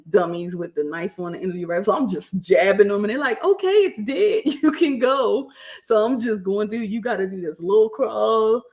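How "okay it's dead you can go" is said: mock-exasperated instructors, high and slow; exasperated dismissal. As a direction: draw it out, higher and louder, imitating fed-up onlookers. Instructors dismiss her over-eager stabbing.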